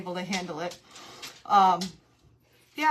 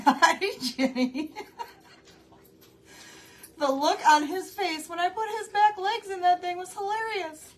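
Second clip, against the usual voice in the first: laughing; 10 semitones higher, 7 dB louder than usual. Real, not acted. Laughing at her dressed-up dog.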